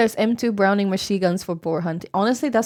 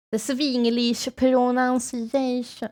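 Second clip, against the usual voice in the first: "The Swinglish pronunciation" slow, with "Swinglish" as "svinglish" and a stretched "pronunciation"; slow, mock-Swedish accented English; 3 semitones higher, slower than usual. Shown as a demonstration.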